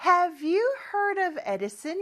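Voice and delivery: slow, rising English question; slow model sentence. Shown as a demonstration. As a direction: model it slowly with a rise. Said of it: "이라고 하면 에디슨에 대해서 들어봤니?". (천천히 올려 묻는 시범 문장)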